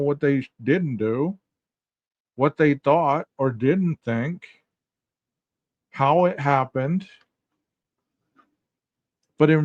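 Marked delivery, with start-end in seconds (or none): 0.0-7.1 slow, each phrase set apart; slow, weighed listing; slow deliberate listing. Real, not acted. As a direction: lay out every clause slowly. Slowing to list hindsight.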